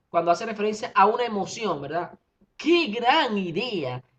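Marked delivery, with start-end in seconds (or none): 2.6-4.0 slow, delighted ¡Qué gran idea!; joyful exclamation. Shown as a demonstration. Teacher showing students an exclamation, delighted. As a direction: exclaim it with real joy. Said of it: "expresando alegría".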